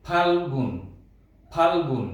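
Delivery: ফাল্গুন with ফ said by closing both lips; lips closed ph. Shown as a demonstration. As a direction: say ফাল্গুন pressing the lips together for ফ. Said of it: "এভাবে ঠোঁট যুক্ত করব". (ফাল্গুন ঠোঁট বন্ধ করে উচ্চারণ)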